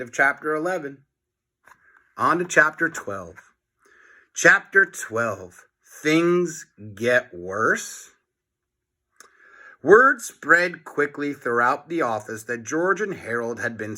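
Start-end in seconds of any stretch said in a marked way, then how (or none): none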